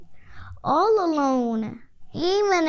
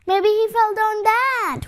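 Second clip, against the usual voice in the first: high, worried wail; cat wailing in fear at the cliff; 8 semitones higher than usual. A narrator's reading. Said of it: "wailed the cat".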